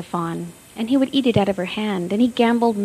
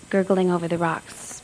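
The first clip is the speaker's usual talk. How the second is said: plain reading voice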